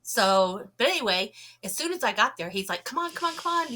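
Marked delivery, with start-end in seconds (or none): 2.8-3.7 high, eager come on; urgent hurrying. Retold or imitated. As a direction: beckon him quickly, pitched up. Witness hurrying him outside.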